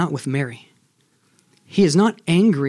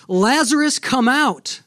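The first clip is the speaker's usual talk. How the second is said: retold yell; 6 semitones higher, slower than usual. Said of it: "He's gonna yell out".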